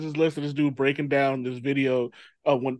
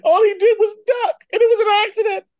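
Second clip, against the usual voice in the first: loud, squealing high protest; high-pitched comic protest; 6 dB louder than usual. Real, not acted. Defending the guy who ducked, high-pitched comic protest. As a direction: plead his innocence in a high, loud voice.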